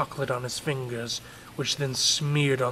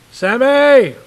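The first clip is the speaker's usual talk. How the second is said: loud call up the stairs; father yelling up to his son; 12 semitones higher, 17 dB louder than usual. Acted. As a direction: shout the name up the staircase. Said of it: "he yelled up the staircase".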